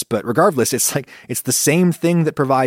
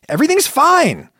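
much higher, louder snapped denial; angry dismissal; 5 dB louder than usual. Retold or imitated. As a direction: snap it out, high and annoyed. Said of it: "And you get angry".

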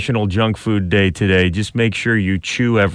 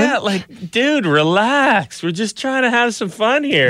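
put-on smug toddler; 10 semitones higher than usual. Voicing the toddler's attitude.

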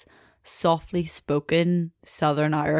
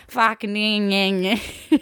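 garbled Australian lads' babble; mangled Aussie accent, words smeared together; mock Australian accent; 3 semitones higher, slower than usual. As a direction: imitate Australian boys as indistinct whiny noise. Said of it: "you wouldn't be making out any words".

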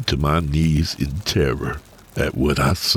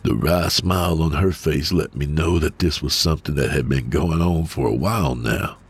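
ordinary delivery

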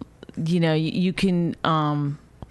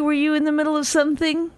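higher, fast sarcastic question; 10 semitones higher, faster than usual. Real, not acted. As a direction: fake innocence, fast and high. Sarcastic reply to interruption.

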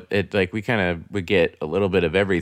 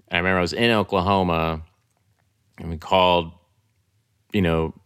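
ordinary delivery

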